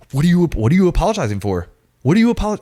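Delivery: loud, high, rapid-fire demand; imitated yelling. Instructor berating an apologetic recruit, yelling. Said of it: "yelling at me in my face".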